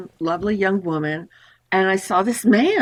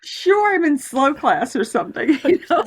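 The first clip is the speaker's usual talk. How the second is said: laughing; 6 semitones higher than usual. Real, not acted. Self-mocking laugh.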